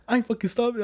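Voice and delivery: high-pitched imitation of a fed-up friend; mock exasperated refusal, pitched well up. Retelling his exhausted friend's reply on the bike ride, fed up. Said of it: "He was fed up".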